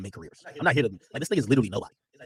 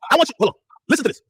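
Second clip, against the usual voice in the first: high, loud demand; high, loud insistence; insistent; 9 semitones higher, 8 dB louder, faster than usual. Real, not acted. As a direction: make them listen. Insisting loudly on stream.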